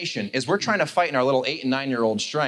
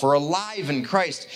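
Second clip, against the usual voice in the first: ordinary talk, nothing marked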